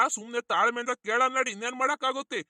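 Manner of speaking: ordinary talk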